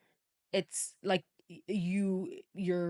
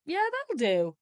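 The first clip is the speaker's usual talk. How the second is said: higher-pitched, put-on Aussie; mock Australian accent; 8 semitones higher, 4 dB louder, slower than usual. Friends settling for a bad take, mock Australian accent. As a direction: play a careless Australian accent attempt. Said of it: "tried to do an Australian accent".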